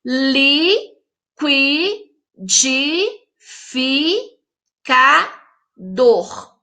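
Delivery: "Liquidificador" split into six very slow, separate syllables. Shown as a demonstration. Teacher showing pupils six syllables, "Liquidificador" chopped up slowly. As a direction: pause after each syllable of "Liquidificador". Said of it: "Tem seis sílabas".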